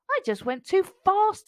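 high, apologetic sigh; sheepish storybook tiger. A narrator's reading. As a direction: sigh out a small excuse. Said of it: "Tiger sighed".

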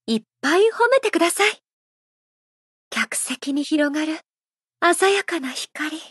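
ordinary talk, nothing marked

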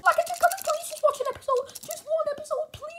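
very high, soft squeaky begging; squeaky pleading wife. Acted. Wife begs husband for one episode, squeaky pleading. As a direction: wheedle in a tiny squeaky voice.

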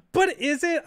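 sudden high, loud teasing question; spring the twist on chat; mock dramatic question. Streamer teasing chat with a mock dramatic question.

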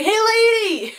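high, loud mock holler; calling out. Teacher hailing the photographer on his way out, calling out. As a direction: shout it out to get someone's attention.

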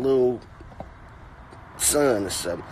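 usual speaking voice

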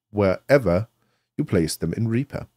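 ordinary talk

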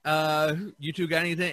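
normal talking voice

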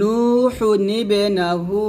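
slow recited নুহু নিবে নাহু with noon qutni, single pesh; Quranic recitation demo. Shown as a demonstration. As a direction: chant নুহু নিবে নাহু slowly, joining with a small noon. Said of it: "এরকম ভাবে আমরা পড়বো". (ধীরে তিলাওয়াত করে নুহু নিবে নাহু)

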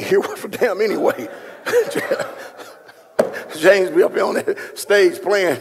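ordinary talk, nothing marked

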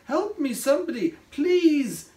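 high, pleading cry; crying for help. A narrator's reading. Tired Craig stuck inside the bottle, crying for help. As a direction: beg loudly to be rescued. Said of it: "he cried".

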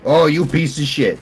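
loud, high burst of cursing; angry shout. Real, not acted. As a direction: shout it in outrage. Cursing opponent in game fight.

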